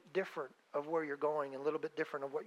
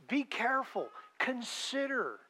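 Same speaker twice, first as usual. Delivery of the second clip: ordinary talk, nothing marked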